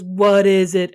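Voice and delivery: imitating a bored older brother; weary, uninterested question; incredibly bored. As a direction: humor him with zero interest. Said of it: "like, sounding incredibly bored".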